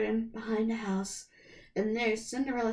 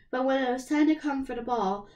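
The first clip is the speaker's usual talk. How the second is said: plain reading voice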